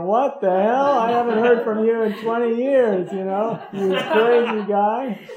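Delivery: loud, high, incredulous friend yelling back; a laughing, yelling what the hell from a long-lost friend; startled friend shouting. Pretend. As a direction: shout it like an old buddy caught off guard. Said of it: "he's gonna go".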